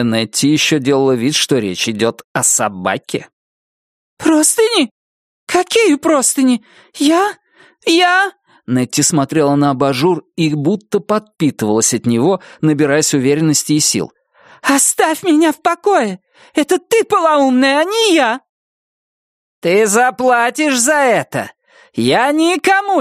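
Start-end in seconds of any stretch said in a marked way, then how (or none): 4.2-8.3 very high, thin, flustered; bewildered Nettie. Acted. Nettie denying the sheets accusation, high flustered squeak. 14.6-18.4 much higher, thin, like helium. Acted. Nettie defending her dog, high thin squeak.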